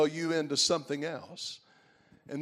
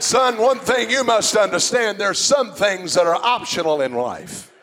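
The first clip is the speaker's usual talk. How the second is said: stern; 4 semitones higher, 6 dB louder than usual. Retold or imitated. Old man voiced in story.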